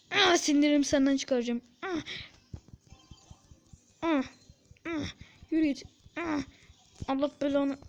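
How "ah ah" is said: ordinary delivery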